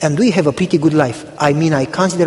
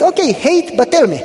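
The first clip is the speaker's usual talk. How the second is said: coaxing; 9 semitones higher than usual. Retold or imitated. Pastor coaxing the woman.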